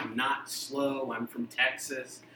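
mock slow Southern drawl, stretched out; slow Texas drawl. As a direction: play up a slow Texan accent.

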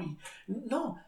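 ordinary talk, nothing marked

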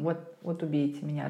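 ordinary delivery

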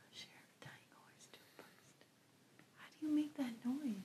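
hushed muttering to self; whispering. Real, not acted. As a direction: whisper it to yourself.

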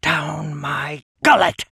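slow gloating genie voice; gloating Djinn. Acted.

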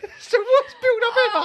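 high, loud wail through laughter; laughing. Real, not acted. Co-host heckling the stalled impression, laughing hard. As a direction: drag it out, helpless with laughter.

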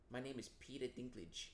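mock Peter Dinklage introducing himself with an accent; put-on accent. Host imitating Peter Dinklage with a light New York accent. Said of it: "give him an accent like that".

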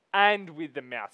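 "and" punched loudly; stress on AND. Shown as a demonstration. Preacher showing heart and mouth go together, loud stress on "and". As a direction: hit the first word "and" hard. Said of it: "No, it says the middle".

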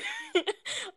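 high, breathy nervous giggle; nervous laugh. Real, not acted. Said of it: "sort of a nervous laugh".

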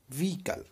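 व्हीकल with silent h, said ʋikəl; व्हीकल without the h sound; correct vehicle. Shown as a demonstration. Teacher models व्हीकल for students, h silent. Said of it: "not व्हीकल नहीं".

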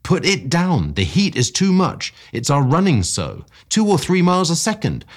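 high, loud urgent warning; cried out. A narrator's reading. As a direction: push the warning out in alarm. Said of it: "I cried".

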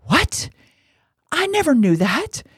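high, astonished gasp of a question; mock stunned woman; surprised. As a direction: rise up in disbelief. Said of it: "she was like".

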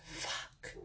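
breathy shocked whisper under the breath; gasped whisper. A narrator's reading. Zink hearing about the coven, a gasped whispered curse. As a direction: let the curse slip out as a stunned whisper. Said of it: "Zink gasped".

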